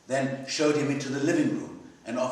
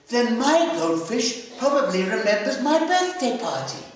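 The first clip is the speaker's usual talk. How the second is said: put-on higher, eager child's voice; a small girl chirping happily; little girl voice; 10 semitones higher, 6 dB louder than usual.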